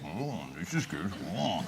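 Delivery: very soft, low, slow murmur; contented stag. Acted. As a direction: murmur it like a pampered animal. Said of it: "and the stag thinks".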